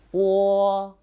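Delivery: Wo held high and level; long, even Wo without any bend; first tone on Wo. Shown as a demonstration. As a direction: sing out Wo on one steady high pitch. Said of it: "Now the first tone".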